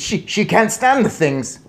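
loud, high, stammered start; panicked yell. A narrator's reading. Father warns about wife and mice, yelling. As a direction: splutter out the protest. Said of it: "yelled Mister Jenkins".

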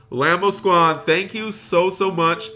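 high, emphatic gratitude; excited thanks. Real, not acted. Streamer thanking a generous donor, excited.

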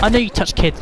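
usual speaking voice

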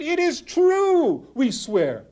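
high, loud, slow protest; both animals begging to be believed; insistent swear. Acted.

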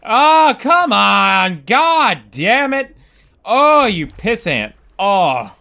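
loud, high, drawn-out cursing; exasperated outburst. Real, not acted. Player cursing his game character's failure, exasperated outburst. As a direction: yell at the game in frustration.

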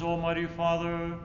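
normal talking voice

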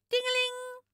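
high loud sung ringing tingeling; bell call. Pretend. Janneke playing fire engine, calling a high bell sound. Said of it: "En Janneke roept".

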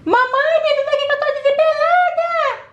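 put-on very high, hurried child calling; desperate little girl. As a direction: call for mum in a panic. Another child calling for help.

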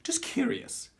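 high-pitched, wounded curiosity; needy questioning. Acted. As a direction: ask in a high voice, stung by rejection. Rejected candidate asks what went wrong.

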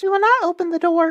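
put-on high, squeaky little-kid cry. A narrator's reading. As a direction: call out as small high-voiced kids. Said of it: "cried they".

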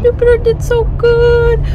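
high, loud and slow praise; high praise for the dog. Real, not acted. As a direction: gush over the brave dog.

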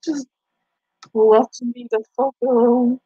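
ordinary delivery

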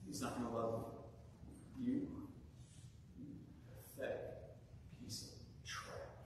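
low, hushed, contemptuous inner voice; self-hatred's voice. Acted. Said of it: "you hear the voice of self-hatred".

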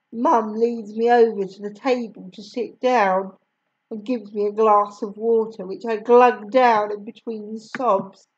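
ordinary delivery, nothing marked

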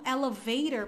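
"elevator" with tapped t and r-coloured ending; American pronunciation of "elevator"; American accent. Shown as a demonstration. Teacher's example of the middle T, American accent. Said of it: "é o americano".

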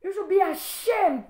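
high-pitched indignant reproach; angry shaming. Real, not acted. Rebuking the commenter for a foolish remark, angry. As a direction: shame them with real heat.

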